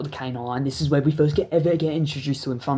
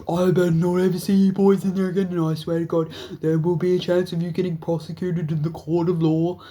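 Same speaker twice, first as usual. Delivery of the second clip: higher, louder stern warning; mock angry cop; 4 semitones higher, 4 dB louder than usual. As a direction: threaten them like a fed-up officer. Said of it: "he's very mad".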